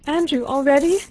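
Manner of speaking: little princess dismayed as husband leaves. A narrator's reading. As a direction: cry it out in shocked dismay. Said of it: "looking with dismay at her husband".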